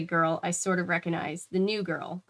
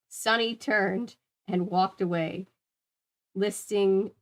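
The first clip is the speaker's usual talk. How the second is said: ordinary delivery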